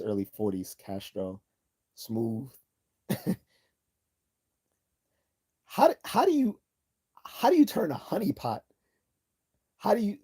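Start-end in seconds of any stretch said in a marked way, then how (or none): 5.7-10.2 much higher, louder incredulous cry; can't believe what he's telling you; amazed disbelief. Real, not acted. Streamer marvelling to chat at Castro seducing the honeypot, higher, louder amazed cry.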